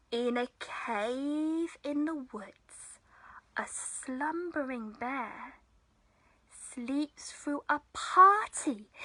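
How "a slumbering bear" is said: ordinary delivery, nothing marked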